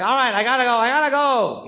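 exasperated gangster son on the phone, rising in pitch; Eddie Spomoso. Acted. Comic demonstrates his phone-to-hand bit, exasperated gangster. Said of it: "And I go, like".

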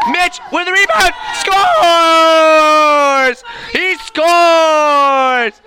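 high drawn-out shouted goal call. Acted. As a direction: yell the goal like an announcer.

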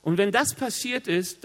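ordinary delivery

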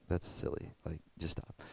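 whispered, breathy dismissal under the breath; mock dismissive whisper. Youth pastor shows how to dismiss the claim, whispered. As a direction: brush it off in a half-whisper. Just be like.

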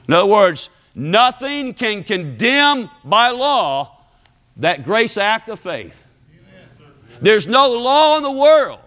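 high, slow, emphatic proclamation; full-force preaching. Real, not acted. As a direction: thunder it out phrase by phrase.

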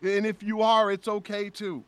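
high, impassioned stage plea; reliving an overwhelmed speech to a crowd; trying not to lose it. Retold or imitated. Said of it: "trying not to just lose it".

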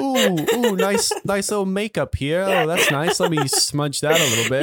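high British crowd-work comic, easing downward; mock British accent. Comic fussing over someone's makeup, high British accent. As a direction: imitate an English comedian fussing over a punter. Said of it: "You do a little British accent".

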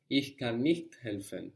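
"nicht" ending in a crisp strong T, light ch; teacher modelling a crisp T in "nicht". Shown as a demonstration. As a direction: hit the T of "nicht" hard. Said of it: "a strong T on nicht".

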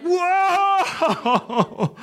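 long, high wail of amazement; comically overwhelmed cry; awestruck whoa. Retold or imitated. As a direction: draw it out high, as if shaken by an earthquake. Reacting as if earthquake hit.

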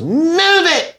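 high, yelled "Move it!"; retold shout of a stranger, pitched high; mock yell. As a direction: bark it out, way up high. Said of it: "and goes".

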